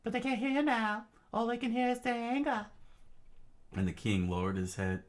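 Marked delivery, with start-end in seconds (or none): 0.0-2.7 Robin. Acted. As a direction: explain it in high character voice. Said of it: "Robin said".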